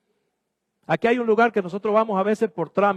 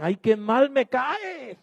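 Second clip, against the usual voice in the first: mock disdain; 4 semitones higher, much slower than usual. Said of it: "te vieron por primera vez y dicen".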